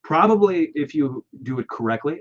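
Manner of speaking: ordinary talk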